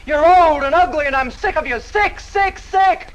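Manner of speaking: loud sneering insult, rising to high repeated sick; contempt. Acted. Kitty telling the old suitor she is sick of him, sneering. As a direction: spit the words at him.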